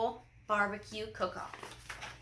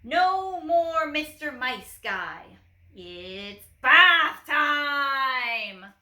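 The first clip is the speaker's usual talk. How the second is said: slow, louder battle cry; shout; 3 semitones higher, 6 dB louder, much slower than usual. A narrator's reading. Officer shouts at the dog before the bath attack, slow triumphant shout. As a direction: drag out the triumphant threat. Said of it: "shouted the Big Cheese".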